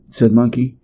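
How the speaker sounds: usual speaking voice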